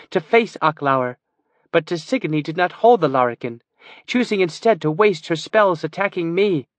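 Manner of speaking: a hint of a proud sorceress justifying herself. A narrator's reading. Sorceress answers the elf's suspicion, lightly voiced and firm. Kiva's voice, defending her plan.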